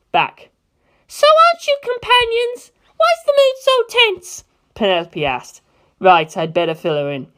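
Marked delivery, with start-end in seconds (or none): 1.1-4.4 put-on much higher, louder young girl's voice; a high, fierce girl set on revenge; Penelope's voice. Penelope asks puzzled.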